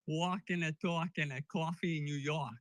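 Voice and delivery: imitated New York accent; mock New Yorker accent; bad Brooklyn accent. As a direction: do a badly faked Brooklyn voice. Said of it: "I apologize for the bad accent".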